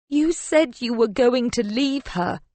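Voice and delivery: high, plaintive whine; whining. A narrator's reading. Mistress reproaching her married lover, whining. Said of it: "I hear the whine in my voice".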